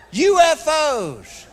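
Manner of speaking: high, loud and very slow; punchline. Real, not acted. Comedian to the crowd, drawn-out punchline. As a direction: stretch it out as the big payoff.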